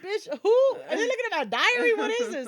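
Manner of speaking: high, loud, disbelieving squeal; high loud laughing disbelief; shocked squeal. Retold or imitated. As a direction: shriek it like someone read your diary. Her shocked reaction, retold.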